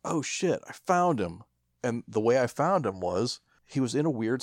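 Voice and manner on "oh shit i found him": surprised find. Retold or imitated. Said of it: "I was like".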